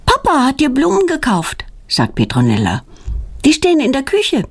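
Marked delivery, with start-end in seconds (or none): 0.1-1.6 Petronella's voice. A narrator's reading. Girl Petronella voiced in story. 3.5-4.4 Petronella's voice. A narrator's reading. Girl Petronella voiced in story.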